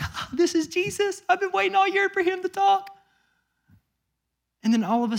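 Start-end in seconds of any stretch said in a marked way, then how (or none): 0.0-3.0 very high-pitched, giddy fan voice; hammed-up excitement in a high put-on voice; excited fans. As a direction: squeal it like a thrilled fan. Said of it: "they're probably excited".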